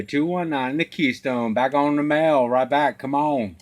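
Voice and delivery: usual speaking voice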